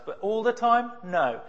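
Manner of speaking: normal talking voice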